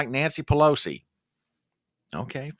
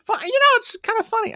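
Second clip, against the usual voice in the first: squeaky voice crack; sudden high squeak; 3 dB louder, faster than usual. Real, not acted. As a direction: voice jumps way up in a squeak.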